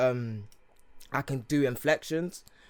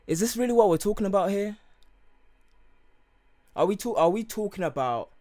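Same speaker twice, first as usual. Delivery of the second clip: higher, louder incredulous questioning; exasperated retort; 9 semitones higher, 7 dB louder than usual. Retold or imitated. Retelling a retort to people questioning their English accent, exasperated. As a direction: challenge them in exasperated disbelief, pitched up.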